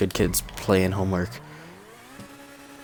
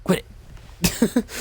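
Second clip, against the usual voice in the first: scolding pets; 5 semitones higher than usual. Real, not acted. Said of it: "Sorry about that".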